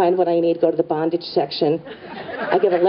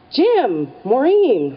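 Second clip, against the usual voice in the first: imitated surprised call-out; 6 semitones higher, much slower than usual. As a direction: call out two names as if spotting friends. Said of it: "this voice that calls out".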